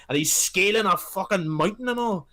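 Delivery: ordinary talk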